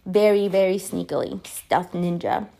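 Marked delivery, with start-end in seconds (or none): none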